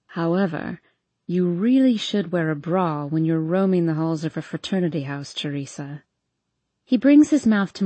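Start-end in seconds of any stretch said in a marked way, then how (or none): 0.1-6.0 low male character voice, lightly teasing; teasing boyfriend. A narrator's reading. Boyfriend chiding her about roaming the fraternity house, low and teasing. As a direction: drop the pitch for the boyfriend.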